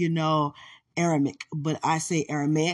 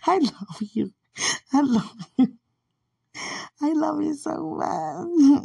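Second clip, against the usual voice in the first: gushing love; 10 semitones higher, 3 dB louder, slower than usual. Real, not acted.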